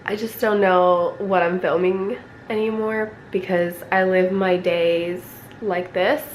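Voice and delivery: ordinary talk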